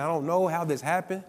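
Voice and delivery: normal talking voice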